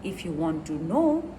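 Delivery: usual speaking voice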